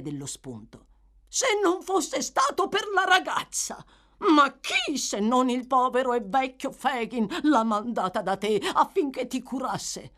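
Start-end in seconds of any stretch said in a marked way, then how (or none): 1.3-10.1 reproachful. Acted. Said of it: "in tono di rimprovero".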